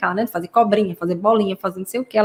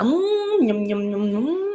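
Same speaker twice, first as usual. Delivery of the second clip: pretend munching; 4 dB quieter, much slower than usual. Shown as a demonstration. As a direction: pretend to chew something tasty, softly. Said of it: "Você finge que está mastigando". (mastigação de faz de conta, com "hum" agudo)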